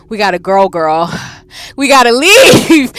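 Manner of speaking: loud, high, slow panicked shout; drawn-out scared yell. Real, not acted. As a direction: yell at her to get out now. Panicked calling out to Jane.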